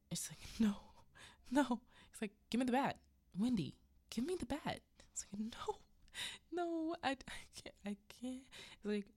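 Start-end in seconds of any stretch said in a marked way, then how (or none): 0.6-1.8 high mock-frightened no; tearful refusal. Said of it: "She's just crying". 2.5-4.8 raised, pitched-up orders; loud acted insistence; insistent demand. Jack demands bat again. 5.4-8.4 high, faint mock whimper; acted crying refusal, breaking off; tearful pleading. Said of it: "She's just crying".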